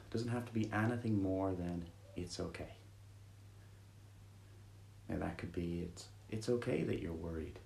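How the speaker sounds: soothing guided meditation read. Acted. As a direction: ease them gently into stillness.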